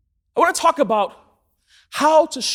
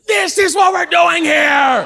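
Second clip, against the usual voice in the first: loud, high and drawn out; frustrated shout; 6 semitones higher, 8 dB louder, slower than usual. Acted. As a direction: blurt it out in acted exasperation. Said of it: "I was so frustrated".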